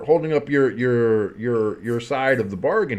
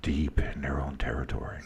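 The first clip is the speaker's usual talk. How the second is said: much lower, much softer, whispered play-by-play; football ASMR; 10 semitones lower, 11 dB quieter than usual. Shown as a demonstration. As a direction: whisper the game call like ASMR. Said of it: "football A S M R".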